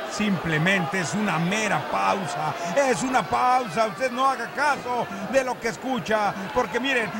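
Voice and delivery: ordinary talk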